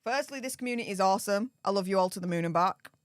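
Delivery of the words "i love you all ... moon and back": ordinary talk, nothing marked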